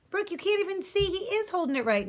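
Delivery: Crab's voice. Acted. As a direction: push back at the fussy old man. Crab voiced arguing.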